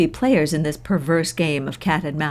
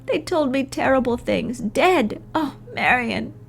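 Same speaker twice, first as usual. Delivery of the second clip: much higher, tearful and fragile; sobbing Laura; 9 semitones higher than usual. A narrator's reading. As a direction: weep through the words. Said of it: "She sobs into my gown".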